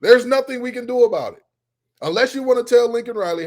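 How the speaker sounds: high, loud, worked-up rant; heated rant. Real, not acted. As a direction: push the pitch up, preaching loud. Ranting about college sports.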